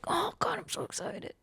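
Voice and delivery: hushed, high-pitched imitated glee; mock excited half-whisper, stretched at the end; retold giddy excitement. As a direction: squeal softly, imitating his delight. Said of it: "happy dance in his bed".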